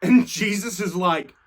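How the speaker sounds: ordinary talk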